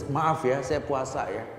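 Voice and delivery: steady, firm polite refusal; firm reply. Shown as a demonstration. Preacher showing the right, firm reply to a provoker. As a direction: hold your ground politely. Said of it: "Yang tegas".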